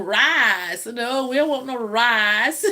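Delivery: "Rice" stretched long in a North Alabama drawl; mock the kids' long North Alabama "rice". Mother imitating schoolkids' North Alabama drawl. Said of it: "pulling their words out long".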